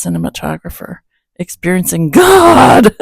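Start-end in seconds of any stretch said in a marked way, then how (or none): 1.4-3.0 loud, grand, high proclamation of Experiencing God; title shouted out with mock grandeur; dramatic title announcement. Shown as a demonstration. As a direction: boom out Experiencing God like a movie trailer. Announcing the book title with flourish.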